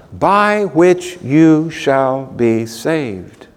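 very slow, word by word; slow emphatic quotation. Acted. Angel's promise to Cornelius, very slow and deliberate. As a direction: spell out each word with weight, drawn out. Key quoted promise stressed slowly.

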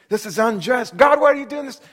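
anguished outcry. Acted. A suffering believer crying out at God, anguished shout. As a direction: hurl the question at God.

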